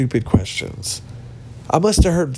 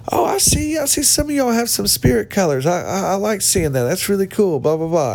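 higher-pitched mock host, gushing approval; 7 semitones higher than usual. Host praising people in Spirit colors, mock gushing. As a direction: imitate an overeager host talking much higher. Mimics the upbeat event host.

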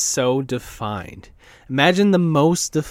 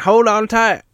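lightly voiced Southern clerk, higher and louder; hint of a gruff clerk; 9 semitones higher, 6 dB louder than usual. Clerk warning riders on the golf cart, lightly voiced. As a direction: call it out like the old clerk on the cart.

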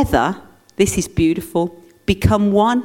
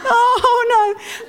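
very high laughing oh no; hammed-up high-pitched despair; mock dismay; much slower than usual. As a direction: squeal it in playful panic. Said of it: "walking around going".

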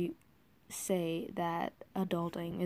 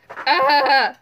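loud sudden yelp; startled yelp; 5 semitones higher, 16 dB louder than usual. Real, not acted. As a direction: yelp in surprise. Startled before recording.

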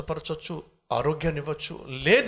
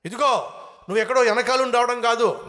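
high, loud ordering; raised-voice command; bossy fox; 7 semitones higher, 5 dB louder than usual. Acted. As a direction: boss the lion around. Fox orders lion about.

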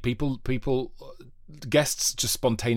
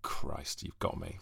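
caught-out mutter; 7 semitones lower, 12 dB quieter, slower than usual. Retold or imitated. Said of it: "He was like".

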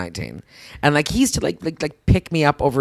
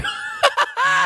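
real shrieking laugh over a confession; high shrieking laugh. Real, not acted.

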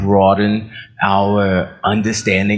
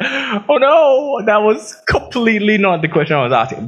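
amused exasperation; 12 semitones higher than usual. Retold or imitated. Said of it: "I was like".

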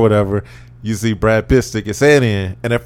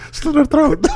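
much higher mock voice; mock urging. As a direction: squeak the order playfully. Voicing Brad's urging.

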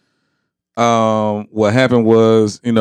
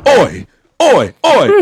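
comic British shouts of oi; hammed-up British heckle; mock British accent; 5 semitones higher, 5 dB louder, slower than usual. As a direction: imitate Brits calling out, laughing between. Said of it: "with the, uh, British accent".

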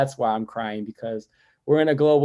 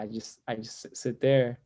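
ordinary delivery

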